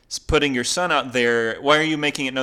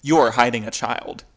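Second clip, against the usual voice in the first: usual speaking voice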